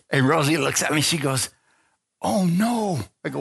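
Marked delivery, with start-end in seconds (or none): 2.2-3.0 high, drawn-out mock dismay; retold dismay. Wife seeing the revival tent, dismayed. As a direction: voice the wife's dread in a higher pitch.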